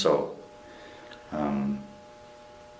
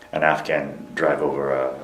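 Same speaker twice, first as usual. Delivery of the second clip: usual speaking voice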